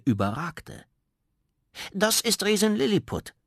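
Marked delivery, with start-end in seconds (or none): 1.9-3.3 hoch und laut, stolz präsentiert; stolz vorstellen. Acted. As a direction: stell deine Freundin voller Stolz vor. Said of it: "stellte Zwerg Goliath seine Freundin stolz vor". (Zwerg Goliath stellt seinem Bruder die Riesin vor, stolz)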